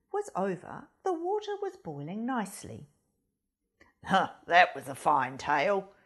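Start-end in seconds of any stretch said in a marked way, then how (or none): 4.0-5.9 much lower, louder fox; hungry fox voice. Acted. Fox speaks to the goose, low and loud.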